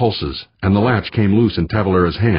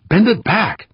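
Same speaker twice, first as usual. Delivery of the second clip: high, urgent command; urgent shouted order; 14 semitones higher, slower than usual. A narrator's reading. Skipper to worker at the stuck latch, urgent shouted order. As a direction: order him sharply at a raised pitch.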